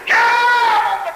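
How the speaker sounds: high, loud yeah; shout of praise. Real, not acted. Preacher shouting praise to the congregation, high and loud.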